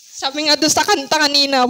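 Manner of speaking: ordinary delivery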